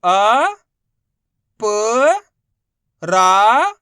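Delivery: loud, held अ, प, रा, each separate; long rising letter drill on अ, प, रा; sounding out letters. Shown as a demonstration. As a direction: call out अ, प, रा with pauses between. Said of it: "एक एक अक्षर का उच्चारण करके".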